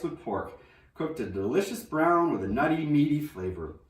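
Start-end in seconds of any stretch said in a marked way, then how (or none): none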